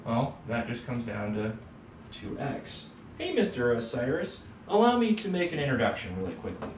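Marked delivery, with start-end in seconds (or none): none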